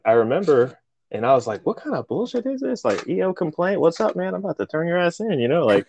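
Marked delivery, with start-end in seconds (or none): 1.6-5.4 high-pitched playful outrage; mock indignant complaint. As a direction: act out a complaint, pitch raised throughout. Acts out his indignant complaint.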